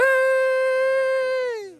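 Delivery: long, high hype yell; excited shout. Real, not acted. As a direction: shout it out to the crowd. DJ answers the hype intro.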